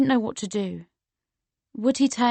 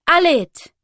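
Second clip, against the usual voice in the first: high, loud shout over music; 8 semitones higher, 10 dB louder than usual. A narrator's reading. Girl shouts to Aled over nightclub music, loud. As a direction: yell his name over the din. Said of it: "I shouted".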